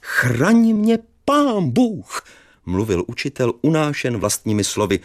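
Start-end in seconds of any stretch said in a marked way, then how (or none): 0.0-2.2 put-on high, slow fussy old schoolmaster; old teacher's voice. Narrator voices the startled old teacher, high and slow.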